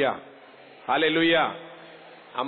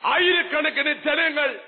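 ordinary talk, nothing marked

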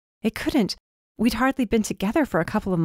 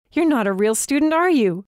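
accusing cry; 7 semitones higher, 4 dB louder than usual. A narrator's reading. Accusing him in a quarrel.

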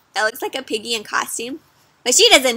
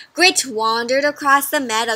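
plain reading voice

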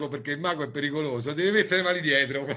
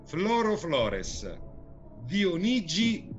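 showy game show host. Acted. Quiz host reading questions.

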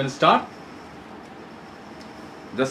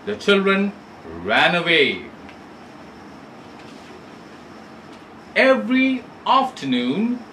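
story reading. Shown as a demonstration. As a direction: read the story clearly to students. Continues reading story text.

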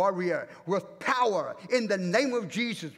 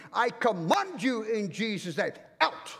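usual speaking voice

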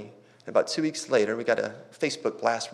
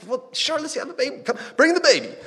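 much higher, cheerful voice of his wife; wife's eager yes; 6 dB louder than usual. Retold or imitated. Wife agrees to take the foster baby, high cheerful imitation. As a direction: chirp it happily as his wife.

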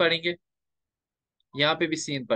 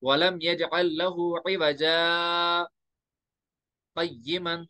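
slow recitation with a clear stop after इवजा before क़य्यिमन; pause before qayyiman; much slower than usual. Shown as a demonstration. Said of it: "तो फिर मैं एक बार पढ़ता हूँ". (इवजा के बाद रुककर क़य्यिमन)

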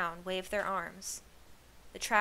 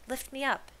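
higher, eager command; urgent cry; 6 semitones higher, 3 dB louder than usual. A narrator's reading. Torrin to Lizzie and Kenny, crying out urgently. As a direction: cry out a sudden idea. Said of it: "he cried to Lizzie and Kenny".

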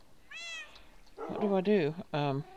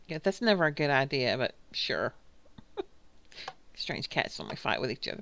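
usual speaking voice

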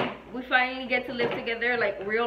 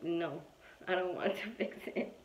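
softer, sulky no with a laugh slipping in; bratty refusal; 5 semitones lower, 10 dB quieter than usual. Retold or imitated. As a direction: pout it out, half laughing. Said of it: "But I was a butthole".

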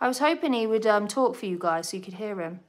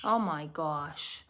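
normal talking voice

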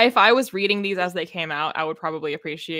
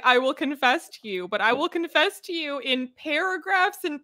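mock dying villain; 7 semitones higher than usual. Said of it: "is like".

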